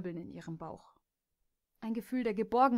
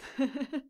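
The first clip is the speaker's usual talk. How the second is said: short teasing laugh with a rising lilt; gloating laugh; 5 semitones higher than usual. Acted. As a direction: laugh at her mistake. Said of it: "begann schadenfroh zu lachen". (schadenfrohes kurzes Lachen)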